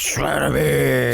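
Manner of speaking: gravelly Batman growl; hammed-up Batman growl. Batman voice imitation.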